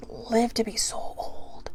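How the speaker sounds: breathy whispered aside; soft whisper of wonder. Real, not acted. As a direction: drop to a hushed whisper. Whispered aside on tortoise age.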